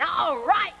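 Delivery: high-pitched hooting cheer; gleeful yell. Acted. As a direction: holler it like cheering kids. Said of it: "we yell".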